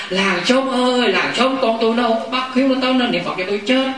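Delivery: high, stretched wail at the start, then a pleading complaint; hammed-up lament of a stubborn old patient; old mother wailing. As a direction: wail like a bedridden mother calling the whole village for help. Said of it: "bà la".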